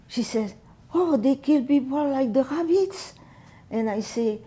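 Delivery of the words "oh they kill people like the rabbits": put-on shocked child's question. Little cousin asking about the war, high and alarmed.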